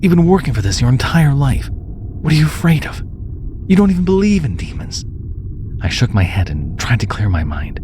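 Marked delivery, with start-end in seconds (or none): 0.0-5.0 anxious self pep talk. A narrator's reading. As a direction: talk yourself out of panic, voice climbing. Panicked narrator talking herself down.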